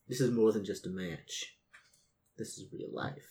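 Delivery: earnest hushed line. Acted. Earnest pep talk in character.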